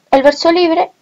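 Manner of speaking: ordinary talk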